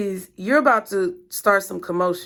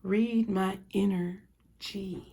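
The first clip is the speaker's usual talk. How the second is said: slow mystic; 6 dB quieter, much slower than usual. Acted.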